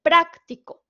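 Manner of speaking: "Práctico" with a loud, high PRÁC; teacher's demo of "Práctico", first syllable emphasized; stress on first syllable. Shown as a demonstration. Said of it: "con una intensidad diferente".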